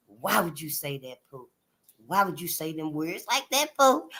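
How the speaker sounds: low, soft reproach; imitated daddy questioning. Daddy asking the child about those words, softly reproachful. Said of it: "my daddy was like".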